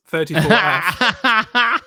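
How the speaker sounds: slow, through laughter; drawn out and giggling; laughing. Real, not acted. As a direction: crack up as you say it. Reads funny comment, laughing.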